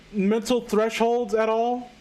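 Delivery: normal talking voice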